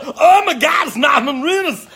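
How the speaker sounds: telling how they met, shouting like a thrilled fan; mock starstruck squeal. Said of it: "and I said".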